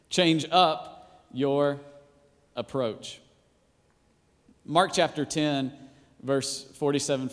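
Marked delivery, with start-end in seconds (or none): 0.1-1.8 change up stretched out very slowly; drawn-out wordplay. Shown as a demonstration. Preacher repeats the pun to his church, slowly. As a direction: linger on change up so they catch the pun. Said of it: "See what I'm doing there?".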